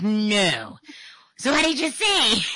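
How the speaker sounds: loud high no, so what did you say; disbelieving. Retold or imitated. Host reacting to mother-in-law's insult, loud high disbelief.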